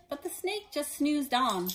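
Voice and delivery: plain reading voice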